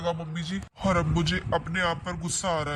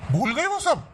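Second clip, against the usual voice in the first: angry retort; 6 semitones higher, 4 dB louder than usual. Acted. Son accuses mother.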